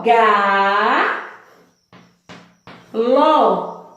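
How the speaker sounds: "ga, ló" in two drawn-out beats, long pause between; split into syllables. Shown as a demonstration. Teacher showing pupils how galo divides, syllable by syllable. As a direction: break "ga, ló" apart, stretching each syllable. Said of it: "pega essa palavra e divide ela".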